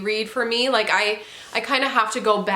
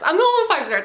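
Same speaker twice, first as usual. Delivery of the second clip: excited declaration; 8 semitones higher, 5 dB louder, faster than usual. Real, not acted. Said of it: "I sound like a crazy person".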